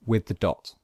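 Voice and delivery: usual speaking voice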